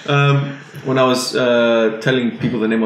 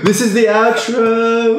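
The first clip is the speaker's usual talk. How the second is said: announcer voice; 11 semitones higher, much slower than usual. Acted. Announcing the outro.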